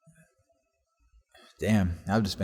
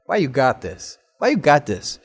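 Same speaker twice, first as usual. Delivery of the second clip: raised, louder guard's questioning; imitated challenge; 5 semitones higher, 6 dB louder than usual. Guard questions teen about cover-up, imitated challenge.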